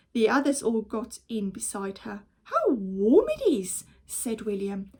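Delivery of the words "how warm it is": put-on higher, louder pleased child; cozy delight. Child snug in nest, happy. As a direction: savor the warmth.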